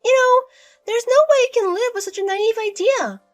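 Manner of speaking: high, loud, sweet-talking mother-in-law; sugary mother-in-law. Acted.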